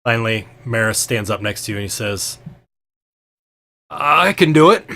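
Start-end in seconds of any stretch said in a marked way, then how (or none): 3.9-4.8 high, loud put-on character voice; nervous volunteer. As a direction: pipe up loudly to volunteer. Volunteering in Maris's voice.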